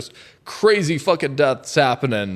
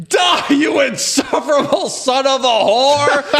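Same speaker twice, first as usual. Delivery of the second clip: much higher, louder mock rage; hammed-up furious yell; 12 semitones higher, 4 dB louder than usual. Man torching the monkey in an alley, hammed-up furious shout. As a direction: scream it like a movie villain cursing.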